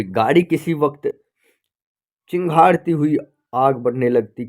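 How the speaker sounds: plain reading voice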